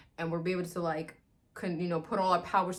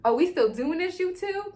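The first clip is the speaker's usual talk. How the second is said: teasing high; 11 semitones higher, 5 dB louder than usual. Retold or imitated. Teasing the couple.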